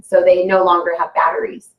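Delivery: normal talking voice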